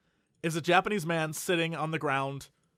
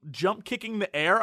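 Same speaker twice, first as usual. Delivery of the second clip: normal talking voice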